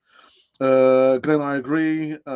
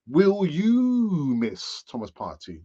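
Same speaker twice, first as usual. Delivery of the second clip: YOU stretched long and high in a slow question; stress on YOU; 3 semitones higher, slower than usual. Real, not acted. Host putting the poll question to viewers, stress on YOU. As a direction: draw "you" out in a rising, falling swoop.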